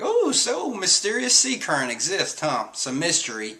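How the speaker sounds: high, dreamy faux wonder; dopey amazed crew. Crew sipping tea, high dreamy mock wonder. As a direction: marvel without understanding a thing. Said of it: "they're like".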